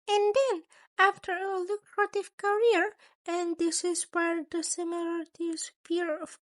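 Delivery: a sly creature principal, amused and menacing. A narrator's reading. Narrator voices Nezu again.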